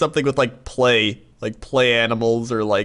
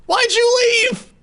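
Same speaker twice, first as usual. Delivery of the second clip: shrill cry of loss, much higher; high anguished cry; slower than usual. Retold or imitated. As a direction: voice jumps very high and wails. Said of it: "And I was like".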